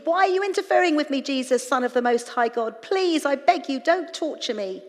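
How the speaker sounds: loud, high, fast outburst easing into begging; screamed plea. A narrator's reading. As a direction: scream it at someone you fear. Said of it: "Then he screamed".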